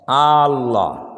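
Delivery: "আল্লাহ" with the opening a drawn out long; stretched alif. Shown as a demonstration. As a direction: pull the first a of "আল্লাহ" long, as a wrong demo. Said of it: "মানে আলিফটা কি করে? টানে।".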